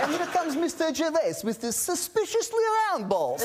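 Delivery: very high, hammed-up Russian voice; silly put-on Russian accent; comic Russian accent. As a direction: trade mock-Russian introductions, pitched right up. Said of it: "why did it have to be Russian".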